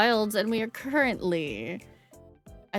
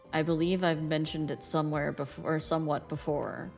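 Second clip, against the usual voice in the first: low, cool Reaper voice, lower pitch; Grim Reaper voice; 5 semitones lower, 6 dB quieter than usual. Acted. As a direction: aloof death god, calm and low. Death's game lines voiced.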